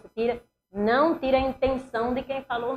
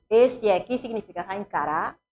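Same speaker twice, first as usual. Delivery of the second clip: ordinary talk, nothing marked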